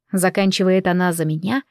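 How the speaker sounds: usual speaking voice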